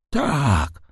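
put-on drawn-out, high, faltering old man's admission; stammered out. A narrator's reading. Old man admitting he paid the debt, faltering. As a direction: let the word falter out, pitched high. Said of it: "wyjąkał starzec".

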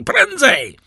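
high, loud shout to hurry on; urgent cry. A narrator's reading. Driver urging the horses home, urgent cry. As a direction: shout it out, voice leaping way up. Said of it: "spłoszone tym krzykiem".

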